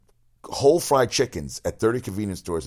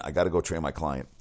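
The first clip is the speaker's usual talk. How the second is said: ordinary talk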